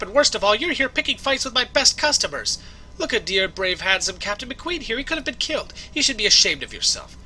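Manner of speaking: indignant doting waitress. Acted. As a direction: fawn over the hero, berate the newcomer. Voices indignant waitress doting on captain.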